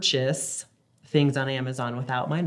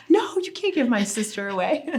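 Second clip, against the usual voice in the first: put-on much higher, louder indignant child voice; protective little boy; 8 semitones higher, 3 dB louder than usual. Mom imitating son defending his baby sister. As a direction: insist like a kid guarding his sister. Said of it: "And he's like".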